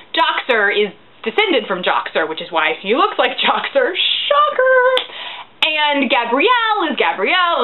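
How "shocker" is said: high-pitched fake surprise; sarcastic, high exclamation; mock shock. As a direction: feign astonishment at the obvious. Mock surprise at obvious twist.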